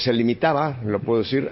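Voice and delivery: ordinary delivery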